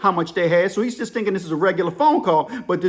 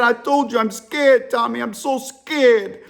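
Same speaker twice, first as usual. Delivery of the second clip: put-on higher, drawn-out African-accented wail; exasperated African caller, begging; imitated scared African caller; 5 semitones higher, 3 dB louder, slower than usual. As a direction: plead slowly in an African accent. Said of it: "The African dude's whispering".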